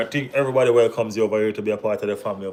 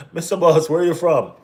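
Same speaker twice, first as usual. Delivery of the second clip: raised, high-pitched calling out; loud challenge; 7 semitones higher, 6 dB louder than usual. Real, not acted. Streamer confronting a viewer about his country, calling out loudly. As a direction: fire the question at him, loud and high.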